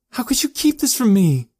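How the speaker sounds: loud, high furious cry; angry growl. A narrator's reading. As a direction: hurl it at him in anger. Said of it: "she growled".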